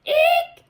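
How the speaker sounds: very high, loud shriek; shrieked eek. A narrator's reading. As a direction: shriek like a startled farmer. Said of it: "shrieked the farmer".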